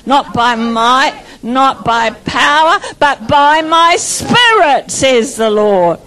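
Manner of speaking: slow, drawn-out scripture, each phrase weighted; fervent declaration. Shown as a demonstration. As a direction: deliver it slow and full of conviction. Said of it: "now you're getting it".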